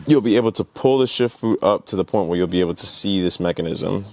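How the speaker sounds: normal talking voice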